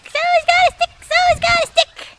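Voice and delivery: very high, slow singsong to the dog; high singsong baby talk. Acted. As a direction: coo it like baby talk to a pup.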